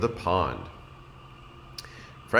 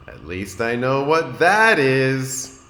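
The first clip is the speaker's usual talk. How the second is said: lightly voiced grinning Franklin; 5 semitones higher, 6 dB louder, slower than usual. A narrator's reading.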